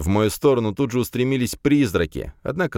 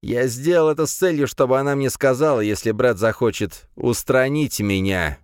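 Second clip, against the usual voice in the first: smirking; 6 semitones higher than usual. A narrator's reading. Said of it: "ухмыльнулся Дмитрий Викторович".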